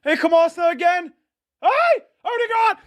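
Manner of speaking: high shout erupting into a long yell; real goal scream. Real, not acted. Goal goes in live.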